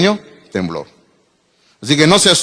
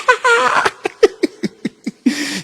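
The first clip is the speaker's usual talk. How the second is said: high shrieking laugh; 3 dB quieter than usual. Real, not acted. Laughing at his own story.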